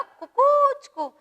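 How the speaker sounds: very high, slow rooster crow. Shown as a demonstration. Teacher asking kids again, crowing high. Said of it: "कसा आवाज काढतो?".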